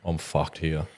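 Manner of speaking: low, hushed realisation; quiet acted dread. Host acts out being stuck in awkward situation, hushed dread. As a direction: say it under your breath, sinking. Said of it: "Real awkward situations where you're like".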